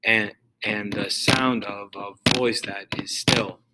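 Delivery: slow da-da-DUM beat landing on "sound", "voice", "still"; two light syllables, then stress "sound", "voice", "still"; anapestic stress. Shown as a demonstration. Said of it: "unaccented, unaccented, accented".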